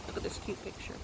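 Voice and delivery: soft whispered aside. Real, not acted. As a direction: whisper it to the listeners.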